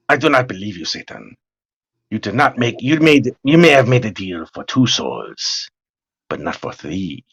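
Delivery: low, grand theatrical hero voice; Ricardo Montalban voice. Retold or imitated. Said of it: "Ricardo Montalban with that fucking voice of his".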